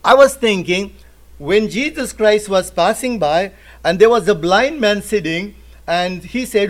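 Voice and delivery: usual speaking voice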